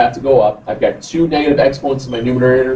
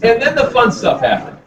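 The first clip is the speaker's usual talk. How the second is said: higher, laughing wry remark; 8 semitones higher than usual. Real, not acted. Teacher joking to the class about the nasty problem, laughing. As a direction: chuckle through the line.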